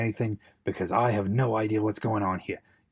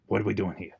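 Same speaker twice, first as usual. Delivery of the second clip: normal talking voice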